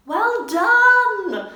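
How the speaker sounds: loud, high, sing-song cheer; calling out. Acted. Fawn cheering the flying owl, loud sing-song call. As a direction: cheer her on from below. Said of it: "the fawn called out".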